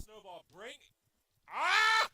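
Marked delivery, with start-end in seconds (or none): 1.5-2.1 short, high, loud "ah"; real high yelp; startled yell. Real, not acted. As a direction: yelp loudly at a setback.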